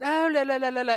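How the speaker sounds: high, lilting mock British accent; playful imitation of a British accent; cute British accent. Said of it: "in that cute little accent".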